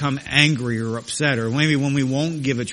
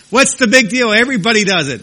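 loud, high-pitched brush-off; dismissive objectors; 7 semitones higher, 8 dB louder than usual. Acted. People shrugging off sin to the preacher, loudly dismissive. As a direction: protest it loudly, as if it were nothing.

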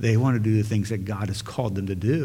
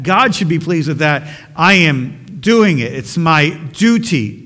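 loud, raised-pitch protest; indignant objection; 4 semitones higher, 7 dB louder than usual. Acted. Church member insisting to pastor that duty is enough, indignant. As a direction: insist forcefully, point by point.

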